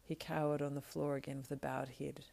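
ordinary delivery, nothing marked

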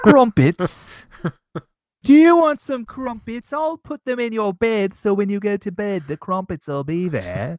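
high, silly English falsetto sliding down; hammed-up English accent. Offering crumpets at bedtime, hammed-up English accent. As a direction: squeal out a ridiculous English nanny-like accent. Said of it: "the worst English accent I've ever done".

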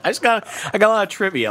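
quick, high-pitched rush of words; talking fast. Real, not acted. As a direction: hurry through it, tripping over yourself. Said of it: "I'm talking a little fast".

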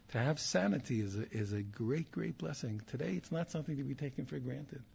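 ordinary delivery

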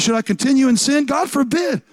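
high-pitched, indignant rhetorical question and answer; "God forbid" thrown out high and firm; emphatic negative. Acted. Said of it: "Most emphatic negative that you can say".

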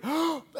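a quick soft gasp for air before diving. Retold or imitated. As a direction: suck in air like going under. Breath before going underwater.

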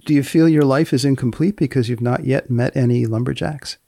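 ordinary delivery

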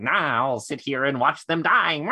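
hammed-up slow villain with a cackle; mock evil gloating; mustache-twirling villain. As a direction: draw it out like a cartoon baddie, then cackle. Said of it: "he needs mustachios that he can twirl while he's like".

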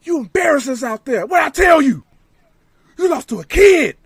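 furious leader berating the losers; imitated angry scolding. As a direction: chew them out like the angry boss.

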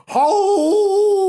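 long, loud, high dog howl; mournful howl. A narrator's reading. Storyteller acting hungry Frank howling in the corner, mournful howl. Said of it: "very sad and mournful howl".

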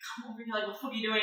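very soft, under the breath; hushed summons from behind the bar; covert scolding. Acted. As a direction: hiss it discreetly across the room. Gwen silently signals from the bar.

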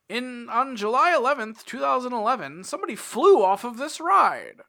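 loud, high vintage newsreel voice; old radio announcer. Pretend. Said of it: "it's more like".

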